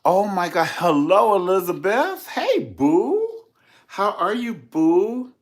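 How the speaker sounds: high, loud excited hello; excited greeting. Real, not acted. Greeting Elizabeth, a high excited hello. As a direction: greet your friend with delight.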